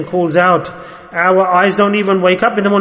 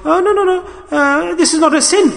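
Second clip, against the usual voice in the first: much higher, mocking the one who shrugs off sin; mocking dismissal; 10 semitones higher than usual. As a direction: squeak out the excuse in mock disbelief. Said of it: "We say it's not even a sin".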